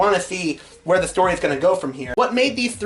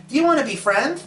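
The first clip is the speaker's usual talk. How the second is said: eager; 8 semitones higher than usual. Acted. Ed eagerly asks Bubbles.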